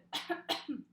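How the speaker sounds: one forceful cough on request; strong cough. Real, not acted. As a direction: give a hard cough. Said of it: "give me a good".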